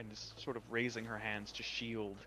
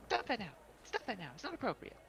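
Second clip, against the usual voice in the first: high, sharp fairy scolding; scolding sprite; 13 semitones higher than usual. Acted. Fairy sprite scolding the pool creature, high and indignant. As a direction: tell them off in a tiny high voice.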